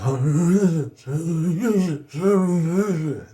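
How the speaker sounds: a strained wordless hum-like sound; the first sound after weeks of silence; imitated hum. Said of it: "what came out was".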